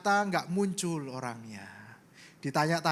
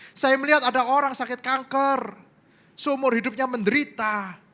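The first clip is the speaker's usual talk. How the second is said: loud, ringing pulpit voice; full-force preaching; 6 semitones higher, 5 dB louder than usual. Acted. Pastor preaching to the congregation, loud and fervent.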